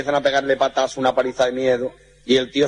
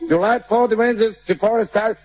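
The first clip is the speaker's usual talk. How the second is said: mock gringo English; 9 semitones higher than usual. American garbled English.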